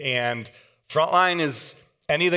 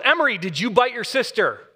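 interrogating dad; 8 semitones higher than usual. Retold or imitated.

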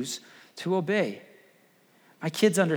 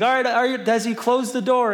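put-on higher, eager little girl's voice; 8 semitones higher, 3 dB louder than usual. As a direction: chirp it like a bossy young sister. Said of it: "she's like".

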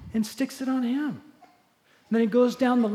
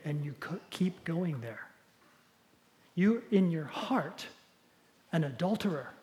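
loving sincerity; 3 semitones lower, 7 dB quieter than usual. Acted. Jesus gently telling Andy his sin, soft and loving. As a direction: tell him tenderly, no blame. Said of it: "saying this with complete love, complete sincerity".

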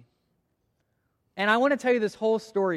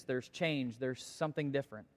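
ordinary talk

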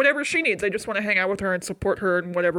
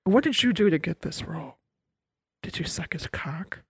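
low, hushed accusation sinking into a whisper; hissed insinuation, ending in a whisper; mock drunken confrontation, dropping to a whisper; Winona Ryder; 4 semitones lower, 4 dB quieter than usual. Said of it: "Winona Ryder with the wine".

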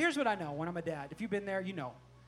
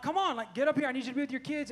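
impatient urging; 6 semitones higher, 5 dB louder than usual. Retold or imitated. Hurrying his lagging son along.

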